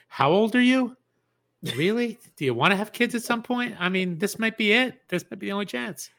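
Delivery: a fretful partner pushing the point; mock anxious pleading. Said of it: "It's like".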